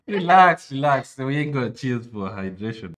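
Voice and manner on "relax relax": high, loud telling-off; loud interjection. Real, not acted. Streamer telling companion to hold off, loud interjection.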